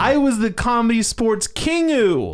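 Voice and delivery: mock royal boast. Improv alum bragging about his comedy title, hammed-up. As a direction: proclaim it like royalty.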